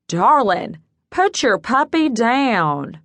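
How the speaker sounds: slow Southern call, down stretched into two syllables; Southern accent. Acted. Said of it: "In a slightly nasal Southern accent, he cried out".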